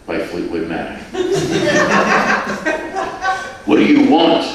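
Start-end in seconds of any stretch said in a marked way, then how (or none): 1.0-3.6 breathy high giggle; laugh. Real, not acted. Narrator laughs at own story. 3.7-4.5 gruff, louder demand; growl. Acted. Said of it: "growled the stocky man".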